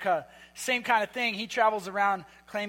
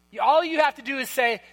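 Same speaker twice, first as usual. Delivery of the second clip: usual speaking voice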